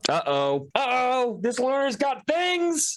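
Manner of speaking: high-pitched, teasing uh-oh; mock alarm. Player teasing another player over a comeback, high mock alarm. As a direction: squeal it in exaggerated alarm.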